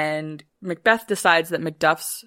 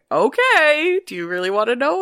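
mock teasing witch; 7 semitones higher, 4 dB louder than usual. Witches teasing Macbeth before the prophecy, high mock coy. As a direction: tease it like a sly witch. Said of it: "they're like".

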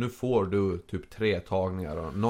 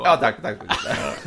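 laughing thanks; 8 semitones higher, 4 dB louder, much slower than usual. Real, not acted. Accepting praise jokingly.

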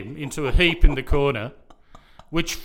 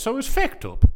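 high playful "fecked" in an Irish lilt; a hint of stage Irish; Irish accent; 10 semitones higher than usual. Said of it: "Oh, it's Irish".

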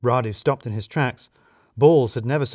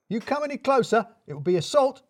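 hysterical Balls; 11 semitones higher than usual. A narrator's reading. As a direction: back away in fright. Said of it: "becoming hysterical".

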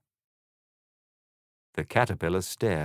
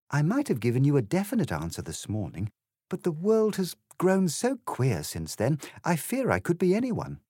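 put-on higher-pitched, confused young heroine; bewildered Alice; 8 semitones higher than usual. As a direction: explain your confusion in a higher voice.

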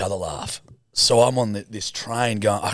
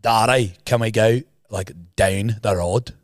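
slower Northern Irish vowels; Northern Irish accent; slower than usual. Shown as a demonstration. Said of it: "In the north, it's like".

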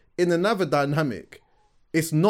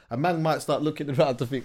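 usual speaking voice

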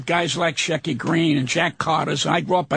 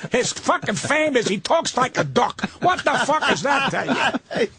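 ordinary talk

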